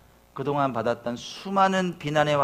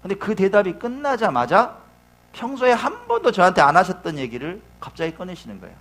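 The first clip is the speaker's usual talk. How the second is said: normal talking voice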